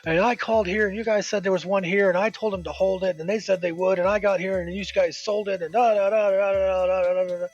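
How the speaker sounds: hammed-up impression of a customer going off; mock yelling customer. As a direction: rattle off his complaint in a mocking rant. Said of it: "starts yelling at me".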